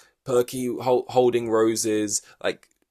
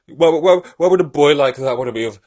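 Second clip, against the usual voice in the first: much louder, higher hammed-up lament; mock wailing; 5 semitones higher, 9 dB louder than usual. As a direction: wail at the sky over being rejected. Boss wailing over rejection.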